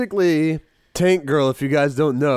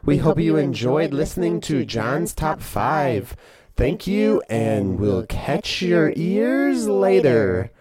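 upbeat, louder radio sign-off; announcer outro; 2 semitones higher, 5 dB louder than usual. Acted. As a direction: wrap up the show like a host.

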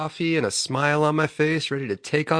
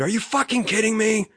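recreate the scream in disbelief, pitched up; retold scream; 10 semitones higher than usual. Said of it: "and I screamed".

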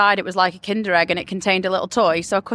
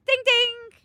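ding ding bell sound; 12 semitones higher than usual. Pretend. Quizmaster marks correct answer.

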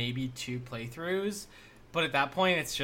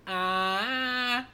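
high sung ah; mock the singer's cry; imitating Seraphine; 11 semitones higher than usual. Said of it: "she does, like, the".

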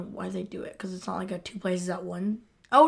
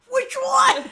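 much higher, louder squeak; squeaky mock Randy; 9 dB louder than usual. To a friend, mimicking Randy's confused question. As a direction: squeal it like a baffled cop. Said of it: "Randy's like".

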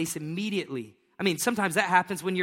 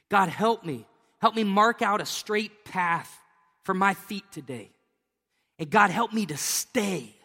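slow, earnest prayer to God; spoken prayer; 3 semitones higher, 4 dB louder than usual. Shown as a demonstration. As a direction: pray it slowly, from the heart. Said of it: "But I'm just gonna say".

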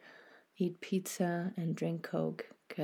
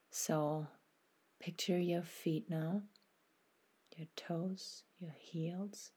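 guided meditation; 4 dB quieter than usual. Acted. Host guiding listeners through a body scan, soft meditation voice. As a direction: ease the listener into stillness, unhurried and gentle.

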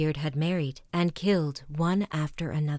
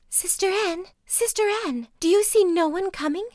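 much higher, louder desperate call; crying out; 11 semitones higher, 4 dB louder than usual. A narrator's reading. Wife crying out to her sister in the tower, high and loud. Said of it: "called out for the last time".

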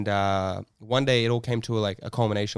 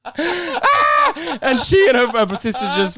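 ordinary talk

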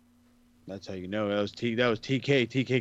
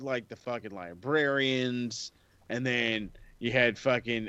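usual speaking voice

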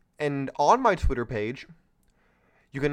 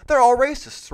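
louder, higher sarcastic mock outcry; mock yell; 10 semitones higher, 8 dB louder than usual. Host mocks the critics' claim with a louder, higher sarcastic yell. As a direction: ham up the accusation.

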